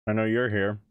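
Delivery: talking through a bite of apple; chewing while talking; mouth full. Real, not acted. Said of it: "Sorry for talking with my mouth full".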